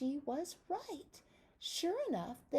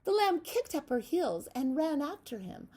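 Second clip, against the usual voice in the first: ordinary delivery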